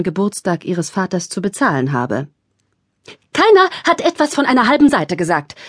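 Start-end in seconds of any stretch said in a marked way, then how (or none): 3.3-5.5 higher, louder indignant complaint; complaining. A narrator's reading. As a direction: complain sharply. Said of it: "beschwerte sich Thekla".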